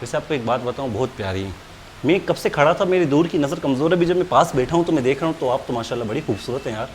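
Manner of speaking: butter her up softly to get the order; mock sweet flattery. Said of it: "अभी तो प्यार से बात कर रहा हूँ". (बहुत धीमी, मीठी चापलूसी भरी आवाज़ में)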